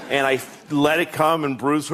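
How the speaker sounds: usual speaking voice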